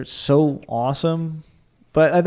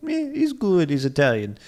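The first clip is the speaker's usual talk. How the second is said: mock Italian cop; 5 semitones higher than usual. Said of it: "they'll be like".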